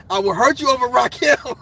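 playful fake menace, half laughing; mock threat. Vlogger teasing a young viewer, playful mock threat. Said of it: "I'm fucking with you, bro".

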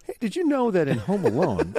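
high, laughing eager voice; eager trivia share. Retold or imitated. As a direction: burst out with a fun fact at the table. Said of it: "You're like".

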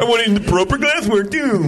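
high, hammed-up demanding snob; exaggerated high-pitched order; mocking pretentious customer. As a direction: parody a fussy beer snob, pitched high. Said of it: "Or you're making fun of".

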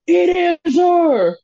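drawn-out squeal of disbelief; gushing fan. Retold or imitated. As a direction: gush like a starstruck drunk fan almost in tears. Second drunk fan, even more thrilled.

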